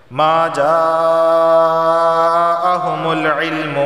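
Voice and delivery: جاءهم chanted very slowly with the long vowel held; long madd. Shown as a demonstration. Teacher demonstrates madd muttasil in جاءهم, long held vowel. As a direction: stretch the madd in جاءهم to four beats. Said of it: "مد متصل کو لازمی چار الف کے برابر".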